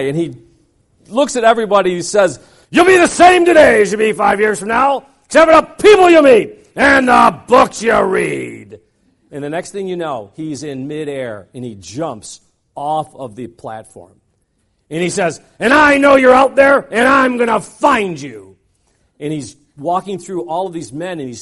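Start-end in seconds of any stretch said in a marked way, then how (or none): 2.7-8.8 booming shout. Retold or imitated. Speaker shouts to crowd. 15.6-18.6 loud, then slow and drawn out; menacing shout. Retold or imitated. Speaker threatens the audience.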